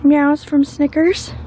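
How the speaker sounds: playful high rising "Meows from Snickers?"; title suggestion. Shown as a demonstration. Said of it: "Is that what we should call this vlog".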